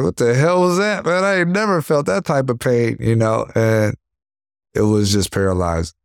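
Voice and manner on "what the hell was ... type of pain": high, stunned exclamation, reliving the hit; high, stunned disbelief at the pain. Retold or imitated. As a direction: retell the shock of a body shot, voice jumping up. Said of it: "I'm like".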